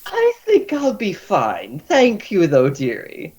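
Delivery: high slow matron voice; doting healer. Acted. Healer declining the offered bear trap. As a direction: turn it down kindly.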